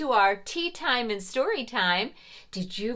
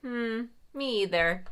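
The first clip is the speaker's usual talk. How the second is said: ordinary talk, nothing marked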